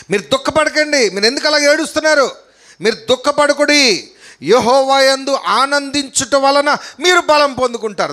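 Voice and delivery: high, sustained scripture proclamation; emphatic preaching. Acted. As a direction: exhort the congregation from the pulpit.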